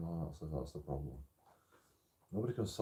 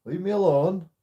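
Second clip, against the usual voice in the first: retold telling off; 7 semitones higher, 6 dB louder than usual. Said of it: "I go".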